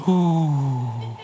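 long, low, falling "ooh"; haunt them playfully with a drawn-out ooh; mock-spooky ooh. Mock-spooky reaction to zone's name.

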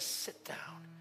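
whispered cold order; hushed command. Retold or imitated. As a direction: hiss it down to nothing. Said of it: "He says".

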